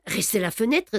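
ordinary delivery

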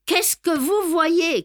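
high, stretched-out call from another room. A narrator's reading. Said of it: "cria-t-il de la salle de bain". (appel crié depuis la pièce voisine, aigu et traînant)

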